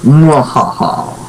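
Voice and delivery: slow mwahaha evil laugh; villain cackle, drawn out slowly; mad scientist laugh. Pretend. Plotting to take over.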